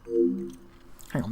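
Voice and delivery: hushed aside with gum in mouth; chewing gum. Real, not acted. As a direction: mutter around the gum. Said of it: "let me get rid of my gum".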